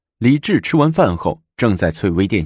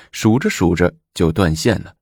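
ordinary delivery

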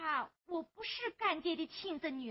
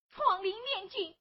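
loud, slow, drawn-out vow; resolute declaration; 2 semitones higher, 10 dB louder, slower than usual. Acted. Girl vowing to the old man to appeal for justice. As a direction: proclaim it with full resolve.

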